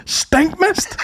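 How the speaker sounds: high baffled echo; mock mum. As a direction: repeat it like the puzzled mum. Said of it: "mocking the mum's question".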